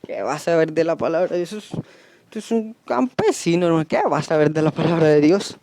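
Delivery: mock someone looking down on a humble preacher; dismissive churchgoer. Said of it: "Viene alguien todo humilde a predicar y dice". (desdén burlón, en voz baja)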